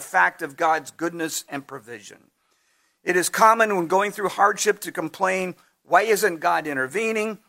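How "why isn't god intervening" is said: ordinary talk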